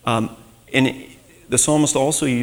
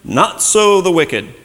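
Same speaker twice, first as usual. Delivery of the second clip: "not so the wicked" slow, higher and louder; emphatic; 6 semitones higher, 5 dB louder, slower than usual. Shown as a demonstration. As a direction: hammer home the contrast. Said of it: "it's emphatic".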